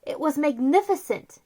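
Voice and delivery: usual speaking voice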